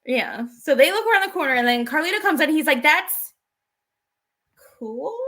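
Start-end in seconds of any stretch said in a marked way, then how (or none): 2.8-5.2 hesitant, puzzled question with a long pause; confused. Retold or imitated. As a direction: trail off into a bewildered question. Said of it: "they're confused about kinda what is".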